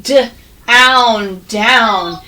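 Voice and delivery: "D-own" split into d and ow, then "down" blended; slow phonics blend; sounded out. Shown as a demonstration. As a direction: stretch "D-own" then say "down" whole. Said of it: "that's right".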